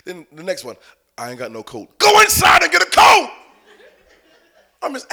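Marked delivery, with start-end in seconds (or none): 1.2-2.0 low, soft mumble; imitated child's excuse. Child admitting to parent there is no coat, mimicked mumble. 2.0-3.3 high, loud exasperated order; parent's shout. Retold or imitated. Parent to child with no coat, exasperated shout.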